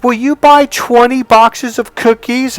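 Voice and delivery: put-on slow, high little-girl sales pitch; coached wheedling voice. Niece begging her aunt to buy cookies, put-on wheedling. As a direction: imitate a girl sweet-talking, drawn out and high. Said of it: "that voice I taught you".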